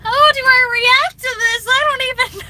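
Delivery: high, loud baffled squeal; flustered surprise. Retold or imitated. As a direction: caught off guard, flustered. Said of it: "I'm like".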